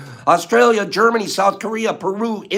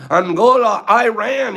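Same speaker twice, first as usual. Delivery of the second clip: ordinary talk, nothing marked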